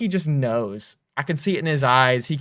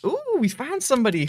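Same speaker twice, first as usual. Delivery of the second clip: excited; 11 semitones higher than usual. Real, not acted. Discovering neighbours in the game.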